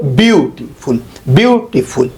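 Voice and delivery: "Beautiful" with weight on view, T-full falling away; long view, then two weak syllables in "beautiful"; dactyl stress. Shown as a demonstration. Teacher showing a dactyl, first-syllable stress. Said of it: "ये दो unstressed सेल बुल हैं".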